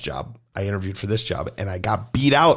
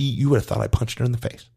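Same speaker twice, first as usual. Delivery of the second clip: ordinary talk, nothing marked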